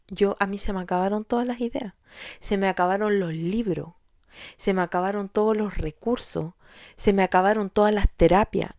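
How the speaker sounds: ordinary delivery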